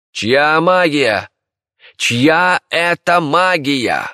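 shouted demand, high and drawn out; high, slow imperial yell; emperor's shout. A narrator's reading. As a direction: cry out imperiously, stretching each word. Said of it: "крикнул император".

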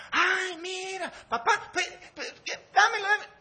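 put-on high, loud, laughing little-girl begging; squealing child pleading for a toy; whiny little girl. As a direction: beg like a greedy child in the store. Pastor voices begging daughter.

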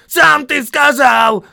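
very high, drawn-out protest yell; wailing yell. A narrator's reading. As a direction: howl that you are blameless. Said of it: "завопил Том".

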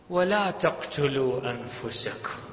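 slow, low intoned verse; Quran recitation. Acted. Preacher citing scripture, grave low recitation.

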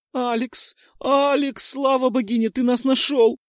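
put-on high, loud girl sobbing with relief; little girl crying out in fake relief. A narrator's reading. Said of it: "всхлипнула Кэссиди".

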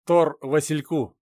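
slow, loud call sign; over walkie talkie. Acted.

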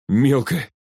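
short of breath with shock; gasped. A narrator's reading. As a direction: gasp the word out. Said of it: "задохнулся Глеб".

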